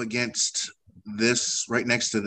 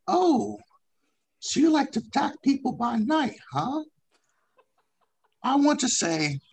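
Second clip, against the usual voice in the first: high, taunting creature voice; mock the attackers in a high squeaky voice; high character voice; 13 semitones higher than usual. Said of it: "Yalar comes out of his shell".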